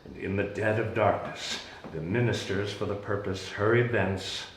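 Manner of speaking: muted, sombre recollection; hushed storytelling. Acted. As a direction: sink into the grim memory. Hushed telling of midnight betrayal.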